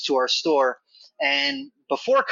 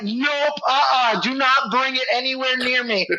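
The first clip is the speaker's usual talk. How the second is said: higher, firm refusal; panicked mom; 7 semitones higher than usual. Retold or imitated. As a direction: back away from the snake, no way. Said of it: "she's like".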